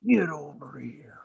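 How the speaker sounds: slow, whispery fighter's catchphrase; Scorpion. Acted. Fan quoting a fighting-game catchphrase, whispery villainous impression. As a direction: draw it out in a voiceless rasp. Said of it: "Scorpion versus Sub-Zero".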